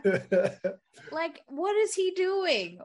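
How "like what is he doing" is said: high, slow exasperated question. Real, not acted. Fan baffled by William's blabbing, exasperated. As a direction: stretch it out in disbelief. Mock exasperation, first take.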